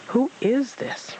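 a hint of a demanding rabbit. A narrator's reading. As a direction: Rabbit demanding of Tigger who this is. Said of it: "Rabbit demanded".

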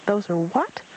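high excited squeak. A narrator's reading. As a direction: pipe it up again like a bouncing joey. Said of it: "Roo squeaked".

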